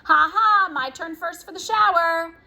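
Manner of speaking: loud, high gloating shout. A narrator's reading. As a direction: crow it at the top of your voice. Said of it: "shouting at the top of his voice".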